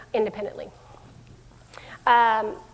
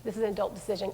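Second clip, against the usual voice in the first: soft, lower, settled; firm calm parent; 3 semitones lower, 9 dB quieter, faster than usual. Shown as a demonstration. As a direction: close the topic quietly. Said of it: "what are those things that we can just say".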